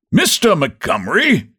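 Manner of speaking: high, ringing call to a colleague; unkindly loud. A narrator's reading. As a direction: call out officiously, a touch too loud. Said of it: "he said, unkindly loud".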